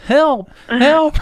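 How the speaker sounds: high, loud yell for help; hammed-up, high-pitched shout from the bathroom. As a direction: re-enact yelling at the top of your lungs. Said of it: "yelling at the top of my lungs".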